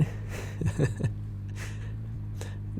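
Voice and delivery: soft, low drawn-out chuckle. Acted. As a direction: chuckle softly to yourself. Teasing detective about being a cop.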